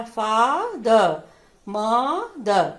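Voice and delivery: "Father" and "मदर" very slow, each split in two; syllables read separately. Shown as a demonstration. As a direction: draw out the first syllable of "father" and "मदर", then drop on the second. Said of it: "दोनों को अलग-अलग करके हमने पढ़ा".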